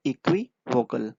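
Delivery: इक्विवोकल said slowly, syllable by syllable; slow careful repeat. Shown as a demonstration. Teacher repeats इक्विवोकल slowly for the class. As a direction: spell out इक्विवोकल slowly for students. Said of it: "मैं फिर से repeat करता हूँ".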